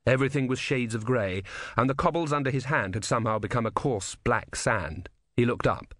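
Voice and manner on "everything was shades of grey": usual speaking voice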